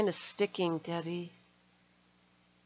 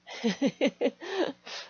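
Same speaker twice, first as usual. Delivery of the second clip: laugh; 6 semitones higher than usual. Real, not acted. Delighted by gift.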